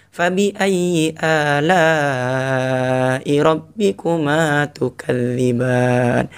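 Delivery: slow melodic chant; gentle recitation, second take. Shown as a demonstration. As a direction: draw it out tenderly. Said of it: "Intonasinya beda kan?". (tilawah pelan kedua)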